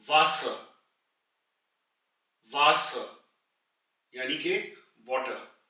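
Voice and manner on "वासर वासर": वासर with a v sound and a light final r; German word demo. Shown as a demonstration. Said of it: "वे". (वासर, हल्के आर के साथ)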